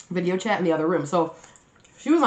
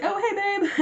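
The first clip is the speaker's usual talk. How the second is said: innocent. Retold or imitated. Acting innocent after shouting.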